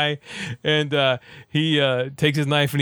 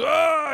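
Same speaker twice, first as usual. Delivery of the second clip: high, sharp mock yell; mock angry yell; 13 semitones higher than usual. Rambo yelling back at Troutman, mock angry yell. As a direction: let out a short burst of fury. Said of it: "Rambo goes".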